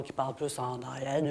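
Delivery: talking from the back of the throat; lightly demonstrated throaty placement; voice placed back. Shown as a demonstration. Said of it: "où est placée la voix".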